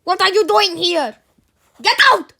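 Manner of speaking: loud, high angry shout; angry growl. A narrator's reading. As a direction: roar it as a furious teacher. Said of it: "saw Henry and growled".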